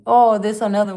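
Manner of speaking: loud groan of here we go again; loud exasperated exclaim. Real, not acted. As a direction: blurt it out at the next troll. New troll comment appears.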